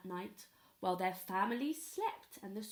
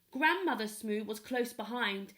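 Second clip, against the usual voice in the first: plain reading voice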